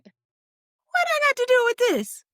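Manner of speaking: high, drawn-out celebrity impression; Nene Leakes. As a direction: stretch it out high, protesting innocence. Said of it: "In her Nene Leakes voice".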